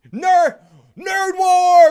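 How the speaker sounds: slow, high, loud battle cry; shouting. Acted. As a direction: bellow it out slowly.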